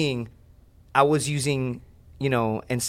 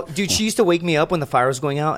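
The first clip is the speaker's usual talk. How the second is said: ordinary talk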